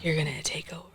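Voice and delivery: hushed encouraging whisper. Retold or imitated. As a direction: whisper it close and soft. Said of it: "he's just like".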